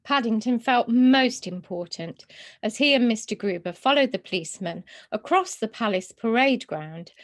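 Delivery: plain reading voice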